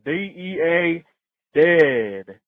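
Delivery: normal talking voice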